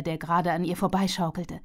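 ordinary delivery

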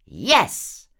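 loud, high exclamation; jubilant call. A narrator's reading. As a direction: call it out triumphantly. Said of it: "rief Horatio W". (freudig gerufen)

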